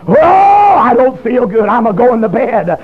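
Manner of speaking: long drawn-out "Oh", then slow, full-voiced wail; preacher's wailing cry. Convicted sinner wants off the phone, told in a preacher's drawn-out cry. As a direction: belt it out slowly like a revival preacher.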